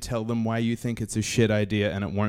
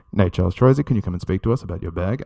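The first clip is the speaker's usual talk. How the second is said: low, official speaker-system voice; PA announcer; 7 semitones lower, faster than usual. Retold or imitated. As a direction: summon a passenger in a lowered, formal voice. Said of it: "And then over the speaker, I hear".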